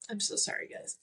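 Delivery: tearful, barely voiced apology; choked up, softly apologising; crying. Real, not acted. Apologising to listeners while crying, tearful. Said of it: "I hate crying on here".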